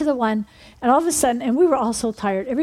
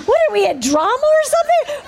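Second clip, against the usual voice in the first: incredulous; 12 semitones higher, 4 dB louder than usual. Retold or imitated. Group's bemused retort to the spirit.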